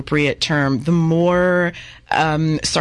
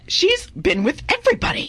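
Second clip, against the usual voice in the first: high, gossipy dish; scandalized gossip; 14 semitones higher than usual. Acted. Gossiping about Minnie.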